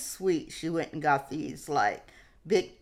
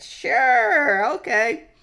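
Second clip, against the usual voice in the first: much higher and louder, burst of joy; eager yes; 11 semitones higher, 9 dB louder, much slower than usual. Retold or imitated. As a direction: jump at the offer. Eagerly agrees to Santa Cruz trip.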